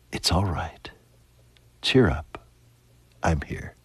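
soft and hushed, dropping to near whisper. Acted. Jesus whispering comfort into a troubled heart, hushed. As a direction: breathe the words gently like a secret comfort. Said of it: "let him whisper to you".

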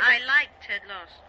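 loud, defiant declaration. Acted. Wife defying her husband on the phone, defiant. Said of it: "when you defy me".

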